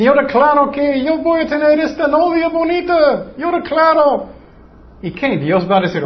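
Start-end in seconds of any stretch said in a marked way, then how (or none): 0.0-4.3 mocking quote. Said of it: "O muchos dicen".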